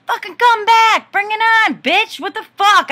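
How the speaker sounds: full-throated furious yell, daring her back; enraged screaming challenge across the parking lot; freaking out. Retold or imitated. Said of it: "I was freaking out".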